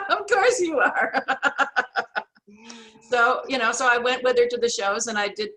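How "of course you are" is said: high, loud, slow laughing repeat; repeating the brother's line while laughing. Retold or imitated. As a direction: chuckle it out in amused disbelief. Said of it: "my brother said".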